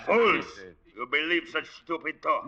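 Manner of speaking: sharp, contemptuous cry of fools; scornful outburst. Acted. Son scorns the villagers' werewolf story, contemptuous. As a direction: scoff at the old men's tale.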